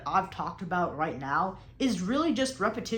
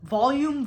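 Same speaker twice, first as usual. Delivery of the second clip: volume punched louder and higher; emphasized word; 5 semitones higher, 6 dB louder than usual. Shown as a demonstration. Coach listing the three V's, stressing volume. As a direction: hit the word volume hard. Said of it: "emphasized the words like variety".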